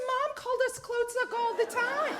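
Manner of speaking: high, loud disbelief; incredulous protest. Retold or imitated. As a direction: insist in shock it can't be. Sister protests, laughing.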